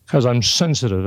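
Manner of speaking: usual speaking voice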